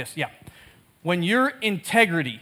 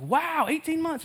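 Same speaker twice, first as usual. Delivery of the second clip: surprised; 8 semitones higher, 7 dB quieter, slower than usual. Retold or imitated. Reacting to Thomas's news.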